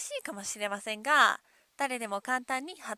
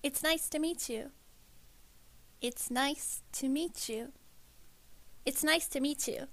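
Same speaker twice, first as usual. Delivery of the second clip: softer native English model, words flowing together; native English accent; 2 semitones higher, 5 dB quieter, slower than usual. Shown as a demonstration. Native speaker modelling the phrase for learners, native English accent. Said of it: "ネイティブの発音をお聞きください".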